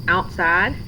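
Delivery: ordinary delivery, nothing marked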